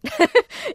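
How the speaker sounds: a quick, high real laugh. Real, not acted. Remembering the night to the host, a high laugh. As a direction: laugh off how baffling it was.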